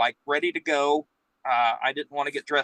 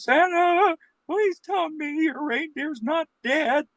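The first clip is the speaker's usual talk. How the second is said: put-on much higher, slow, pleading child's voice; distraught little girl; 14 semitones higher, slower than usual. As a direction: beg Santa like a tearful little girl. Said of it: "the first child is so distraught, crying".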